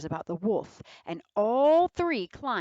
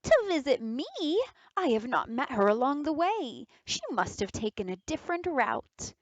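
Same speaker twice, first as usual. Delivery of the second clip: mock surprise; 7 semitones higher than usual. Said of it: "The wolf acted surprised".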